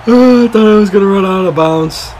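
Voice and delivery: high, loud, stretched-out exclamation; elated disbelief. Real, not acted. Streamer cannot believe his return run, loud high elation. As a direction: cry it out high, then draw it out slowly.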